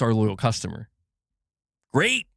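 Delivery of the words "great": high, rising mock-pleased "Great"; sarcastic cheer. Real, not acted. Streamer reacting to uncaring publishers, sarcastic cheer. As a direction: fake delight, pitched up.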